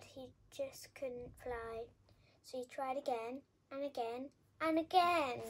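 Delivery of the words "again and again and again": each AGAIN bigger, last one loud; rising storyteller crescendo on each "again"; building repetition. A narrator's reading. Repeated tries, narrator builds to climax.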